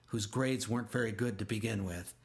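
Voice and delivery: ordinary delivery, nothing marked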